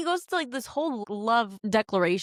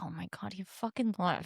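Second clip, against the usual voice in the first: hushed shock; 2 semitones lower, 9 dB quieter than usual. Retold or imitated. Reader's shock, Shadow Gate discovered.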